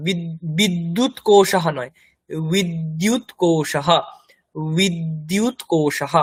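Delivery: বিদ্যুৎ কোষাহ slow, breathy -ah ending; Sanskrit visarga. Shown as a demonstration. Teacher correcting the word for battery, slow. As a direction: draw out কোষাহ and breathe the final h. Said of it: "বিদ্যুৎ কোষ তাহলে ভুল হবে".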